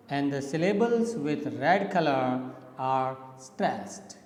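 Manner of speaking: normal talking voice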